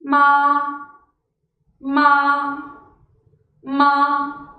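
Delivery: long level MA; drawn-out, steady MA with no rise or fall; first tone. Shown as a demonstration. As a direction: hold "Ma" on one straight, unchanging pitch. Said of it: "this is how it sounds".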